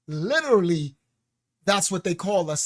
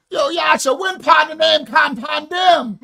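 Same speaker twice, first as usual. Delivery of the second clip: shouted, laughing mock Jamaican patois; botched Jamaican patois; 8 semitones higher, 8 dB louder than usual. Calling to Yasha in mangled Jamaican patois. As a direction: butcher the Jamaican patois while cracking up. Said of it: "I know I'm slaughtering it".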